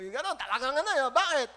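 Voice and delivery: ordinary delivery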